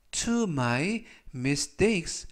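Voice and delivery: very slow, each word clear; slow model reading. Shown as a demonstration. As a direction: read it slowly for learners. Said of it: "활용 문장 보시겠습니다". (천천히 또박또박 읽는 예문)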